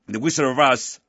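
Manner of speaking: normal talking voice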